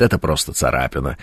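low, quiet, slowed mock stoic voice; brave understatement. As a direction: lightly play a wounded man brushing it off. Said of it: "Лежит человек уже, всё сознание теряет". (тихо и низко, как стойкий раненый)

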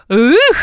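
high-pitched comic exclamation; playful high "ekh". Pretend. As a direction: toss out a high, mock-folksy whoop. Comic repeat of instrument name.

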